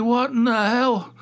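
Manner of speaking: what the hell. Retold or imitated. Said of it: "I'm like".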